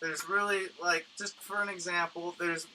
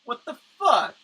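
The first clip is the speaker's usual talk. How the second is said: higher, louder indignant yell; mock outrage; 8 semitones higher, 11 dB louder than usual. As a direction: act insulted by the drawing. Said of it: "you're like".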